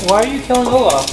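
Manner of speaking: normal talking voice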